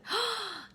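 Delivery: sharp happy gasp; delighted gasp. Real, not acted. Delighted at Karen's share.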